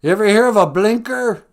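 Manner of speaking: high, loud yell at another driver; road rage yell. Retold or imitated. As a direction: scream it at a stranger who cut you off. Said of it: "screaming at strangers".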